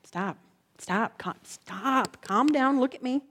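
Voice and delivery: hushed, low and soothing; gentle whisper. Acted. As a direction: softly halt someone who is panicking. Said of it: "when Jesus whispers your name and says".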